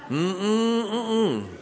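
high hummed "mm-mm", no way; hummed refusal. Retold or imitated. Man declining to be taken for a doctor, high hummed refusal. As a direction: hum a high-pitched no.

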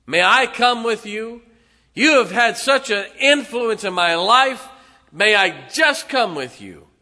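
loud, high pleading cry, begging hard; desperate plea. Acted. Freed man begging Jesus on the ship, in a loud high desperate plea. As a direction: cry out to be taken along, voice straining.

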